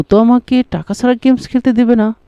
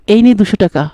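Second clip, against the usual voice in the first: ordinary talk, nothing marked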